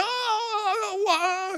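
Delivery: high mock cry ah wah; hammed-up crowd wail; imitated wailing. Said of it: "pokoknya teriak-teriak semua". (tiruan ratapan tinggi)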